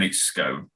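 "scone" rhyming with stone; long-O pronunciation. Shown as a demonstration. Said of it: "there's an E on the end of it".

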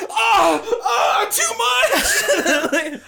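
very high, loud, laughing mock cry; drowning plant. Plants overwatered by the rain cry out in a high squeal. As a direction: choke out the words in a squeaky plant voice.

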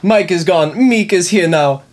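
lightly voiced bad Jamaican accent; mock Jamaican accent. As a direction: announce it in a hammed-up Jamaican accent. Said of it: "I'll do Mike trying to do a Jamaican accent".

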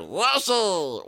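silly high Yoshi squeal, falling at the end; stupid Yoshi voice. Acted. Host showing off the voice that annoys his wife, stupid Yoshi voice. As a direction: do a daft, high-pitched Yoshi call. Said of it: "do a really stupid voice".

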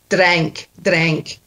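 Scottish "drink", almost "drank"; sounds like past tense. Shown as a demonstration. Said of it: "sound like the word in the past tense".

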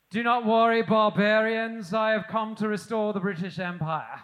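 put-on loud, posh-accented imperial proclamation; mock British soldier. Father imitating his son as a British soldier. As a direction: declare it like a pompous British officer. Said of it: "he walks now like he is a, a British soldier".

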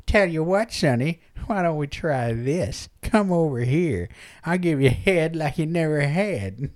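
soft, coaxing crone voice; old madam. Acted. Old madam offering herself to a customer, coaxing. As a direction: offer yourself like a wily old woman. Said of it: "she's all old".

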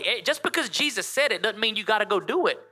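ordinary talk, nothing marked

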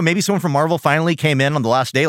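normal talking voice

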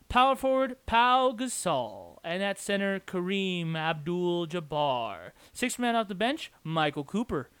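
drawn-out names in an announcer's cadence; arena announcer. Acted. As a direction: introduce a starting lineup, stretching each player's name. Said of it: "That's how I would say it if I was a, uh, announcer".

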